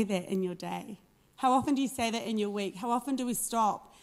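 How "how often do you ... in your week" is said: ordinary talk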